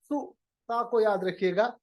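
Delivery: ordinary talk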